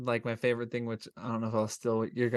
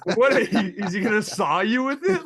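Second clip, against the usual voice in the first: loud, higher, incredulous mock question; cracking up in mock outrage; laughing disbelief; 7 semitones higher, 8 dB louder than usual. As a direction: challenge it through laughter. Incredulous mock reply to film character.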